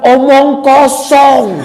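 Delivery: put-on slow, high, drawn-out child's blurt; a cheeky kid blurting it out slowly in the pause; child's blunt remark. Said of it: "dia bilang".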